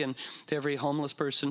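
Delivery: ordinary delivery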